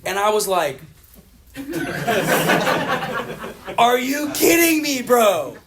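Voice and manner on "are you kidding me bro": high laughing "are you kidding me"; loud laughing disbelief at his friend. Retold or imitated.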